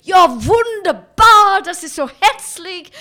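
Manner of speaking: very loud, high, booming German cheer; mock jolly après-ski shout; exuberant Austrian. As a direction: bellow it like an overjoyed skier. Exuberant Austrians in guest house.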